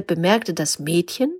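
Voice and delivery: plain reading voice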